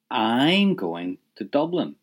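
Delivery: I'M drawn out and leaned on; slow, weighty emphasis on "I'm"; stress on I'M. Shown as a demonstration. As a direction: lean into "I'm", then finish the sentence. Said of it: "Did you hear I leant into that?".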